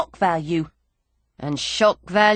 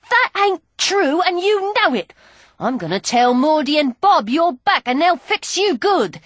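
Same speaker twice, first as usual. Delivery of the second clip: high, loud protest; indignant outburst; 7 semitones higher, 4 dB louder than usual. Acted. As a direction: fight back hard. Angry girl fights back.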